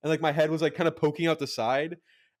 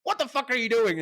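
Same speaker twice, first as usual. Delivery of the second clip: angry yell; 8 semitones higher than usual. Retold or imitated. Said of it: "they got into a fight".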